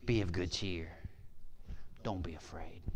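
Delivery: soft, low, near-whispered reassurance; calm reassurance. Retold or imitated. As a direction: hush it to calm the frightened. Calm Jesus answering frightened disciples.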